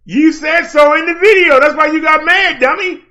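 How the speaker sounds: loud jeer. Real, not acted. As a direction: yell it in his face. Shouting at rival.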